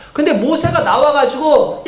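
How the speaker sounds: ordinary talk, nothing marked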